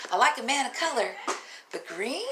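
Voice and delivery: usual speaking voice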